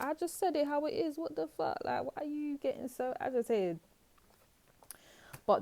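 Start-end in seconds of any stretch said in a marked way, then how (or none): 0.0-3.8 much higher, mocking voice of the careless spouse; mock the rude partner who brushes it off; dismissive partner. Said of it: "And they're just like".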